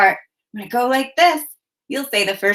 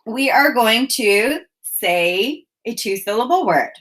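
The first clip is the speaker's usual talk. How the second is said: usual speaking voice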